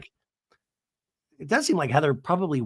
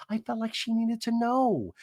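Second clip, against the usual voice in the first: high, softer put-on voice; Tamara's carrying voice; 5 semitones higher, 5 dB quieter than usual. Host mimics Tamara justifying herself, high soft carrying voice. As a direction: imitate her, pitched up and lightened. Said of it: "Tamara's carrying voice".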